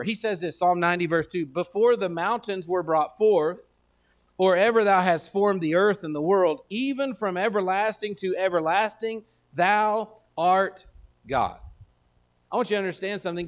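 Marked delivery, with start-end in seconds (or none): 1.5-11.6 slow, louder solemn reading; solemn Bible reading; scripture reading. Acted. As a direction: proclaim the psalm slowly. Reads the psalm aloud.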